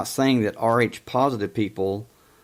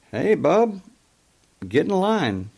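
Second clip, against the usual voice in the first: dismissive retort; 8 semitones higher than usual. Retold or imitated. Mocking retort to the boss.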